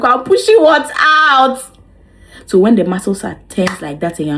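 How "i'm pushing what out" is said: surprised. Retold or imitated. Confused reply to midwife.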